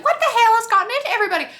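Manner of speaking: very high, loud and fast exasperated shriek; high fast indignant screech; shrieking disbelief. Retold or imitated. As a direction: fire it off like a scandalised diva. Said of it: "she's like".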